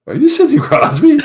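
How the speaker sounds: high, loud, half-laughing question; star-struck kid. Retold or imitated. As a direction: blurt it out in disbelief, laughing. Star-struck kid recognising Crosby.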